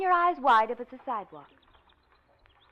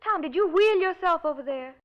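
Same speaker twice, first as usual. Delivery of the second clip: ordinary talk, nothing marked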